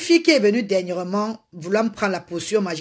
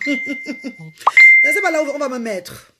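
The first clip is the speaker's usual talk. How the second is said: laughing; 3 semitones higher, 3 dB louder, much slower than usual. Real, not acted. Laughing on the livestream.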